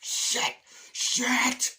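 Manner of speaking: high, loud frantic swearing; panicked yelp. Retold or imitated. Shoe caught, dog attacking.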